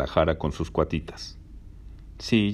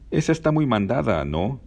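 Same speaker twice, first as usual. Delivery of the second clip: indignant, pitched higher and louder; protesting; 7 semitones higher, 3 dB louder than usual. A narrator's reading. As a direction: call them out as too forward. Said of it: "Protestó Janina".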